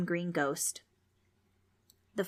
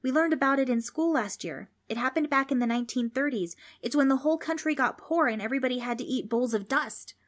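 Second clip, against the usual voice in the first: put-on higher-pitched kid reciting a lesson; higher know-it-all boy voice; eager schoolboy Preston; 6 semitones higher than usual. A narrator's reading. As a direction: explain it like a keen pupil.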